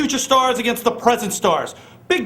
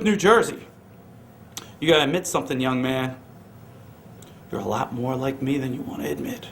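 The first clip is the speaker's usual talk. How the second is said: usual speaking voice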